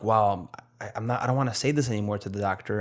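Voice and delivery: ordinary talk, nothing marked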